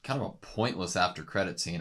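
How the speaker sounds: dry sarcastic remark, said low. Real, not acted. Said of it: "That was me being sarcastic".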